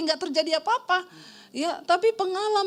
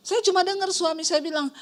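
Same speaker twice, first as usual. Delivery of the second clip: usual speaking voice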